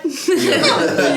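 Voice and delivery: laughing. Real, not acted. GM laughing through line.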